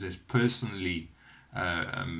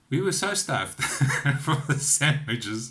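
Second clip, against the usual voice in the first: amused, laughing through the words; 4 semitones higher, 5 dB louder than usual. Real, not acted. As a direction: break into a laugh mid-sentence. Laughs recalling being overfed.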